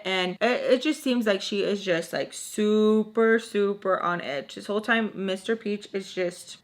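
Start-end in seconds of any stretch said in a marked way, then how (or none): none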